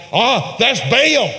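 high, drawn-out awed cry; excited exclamation. Acted. Baal's followers seeing lightning flash, excited exclamation. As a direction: exclaim it slowly, pitched well up.

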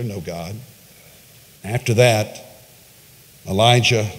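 normal talking voice

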